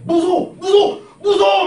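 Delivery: high, loud repeated scared shrieks; a kid screaming in fear from the back seat; frightened screaming. Retold or imitated. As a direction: scream it over and over like a terrified child. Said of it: "소리를 막 지르더래".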